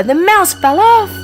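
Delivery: usual speaking voice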